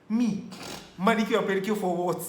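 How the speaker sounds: high, loud disbelieving rant; incredulous outburst. Retold or imitated. Boyfriend recalling the pricey pedicure, high incredulous outburst. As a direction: protest high and loud, laughing.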